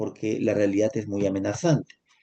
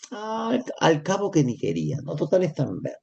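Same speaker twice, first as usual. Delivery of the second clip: desdén fingido; 5 semitones higher than usual. Acted. Zorra se justifica.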